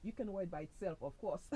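usual speaking voice

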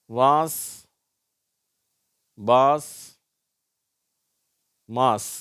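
வாஸ், பாஸ், மாஸ் with long open "aa"; drawn "aa" in வாஸ், பாஸ், மாஸ்; long "aa" pronunciation. Shown as a demonstration. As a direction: model வாஸ், பாஸ், மாஸ் loudly, one at a time. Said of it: "ஆ என்று உச்சரிக்கப்படுகிறது".

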